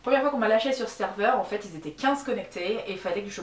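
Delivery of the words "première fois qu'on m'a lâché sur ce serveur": ordinary delivery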